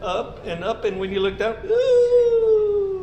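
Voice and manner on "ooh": high squealed ooh at the height; a dizzy, delighted ooh; awed ooh. Acted. Looking down from high slide.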